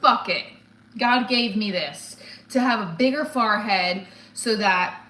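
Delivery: normal talking voice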